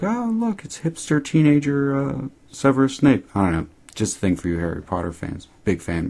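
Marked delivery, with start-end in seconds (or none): 0.0-3.3 spotting someone funny in the background, amused; delighted surprise. Retold or imitated. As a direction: pitch shoots much higher on oh, look, then stays high. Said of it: "I was like".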